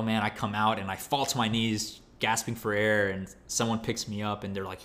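ordinary talk, nothing marked

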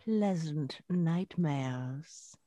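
slow, soft creepy crone voice; old hag. Acted. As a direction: draw it out like a sinister hag. Spooky sign-off before witch laugh.

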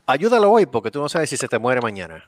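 cheesy charity ad voice; mock commercial read. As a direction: sell it like a TV charity appeal.

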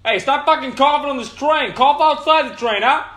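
loud, high mock outrage; angry subway stranger. Mocking an offended subway rider yelling at him, loud and high. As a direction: yell like an offended stranger on the train. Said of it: "is gonna be like".

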